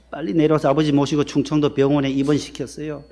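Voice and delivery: usual speaking voice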